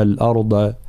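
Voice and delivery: normal talking voice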